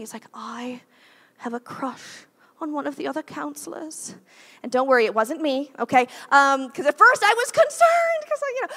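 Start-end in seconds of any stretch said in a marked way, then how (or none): 0.3-4.1 soft imitated British accent; lightly voiced English lad confessing a crush. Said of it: "in his little British accent". 6.7-8.7 high, loud comic outburst; hammed-up loud worry; mock alarm. Joking alarm at crush being hers.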